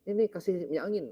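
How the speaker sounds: put-on an older Chinese man urging the balm, excited; Pak Yongkian's voice. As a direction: hurry to hand over the balm. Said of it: "Chinese, biasa suka pakai minyak angin".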